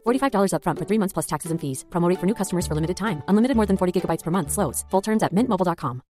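rapid-fire fine print, x1.5; fast legal disclaimer. Acted. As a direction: rattle off the terms at top speed. Mobile ad fine print read.

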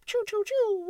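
high mouth laser sounds, choo choo; squeaky pretend laser fire; blaster noises. Telling him to prove it, so you fire mouth lasers. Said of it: "And you go".

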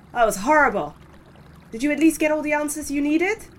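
normal talking voice

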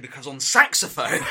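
ordinary talk, nothing marked

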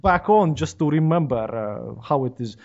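put-on thick, deliberate Soviet-accented English. Putting it back on to remember, exaggerated Soviet accent. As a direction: lean heavily into a comic Soviet accent. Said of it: "I must put it back on".

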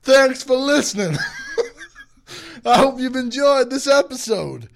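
high, loud outro through giggles; laughing sign-off. Acted. Host signing off the podcast, laughing. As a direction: wrap up the show while cracking up.

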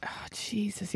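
breathy shocked whisper. Real, not acted. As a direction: barely voice it, appalled. Reacting in disgust.

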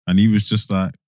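ordinary delivery

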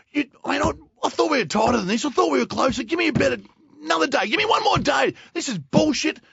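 high, loud, ranting yell; retold tirade. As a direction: lose it at the coach all over again. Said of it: "And I've teed off".

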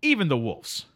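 sleepy Canadian drifter greeting his pal; Canadian accent. Acted. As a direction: play the neighbourly Canadian couch guest. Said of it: "Our friend from the north".

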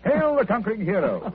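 high, grandly teasing salute; mock-heroic. Holmes greeting Watson back from his case, mock-heroic. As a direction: hail him with playful pomp.

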